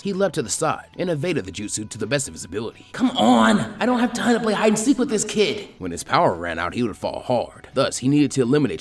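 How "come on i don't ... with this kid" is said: high, loud exasperated shout; frustrated yell. Acted. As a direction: bark it out at an unseen enemy. Frustrated fighter yells at hiding foe.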